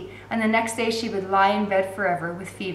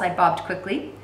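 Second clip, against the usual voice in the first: ordinary delivery, nothing marked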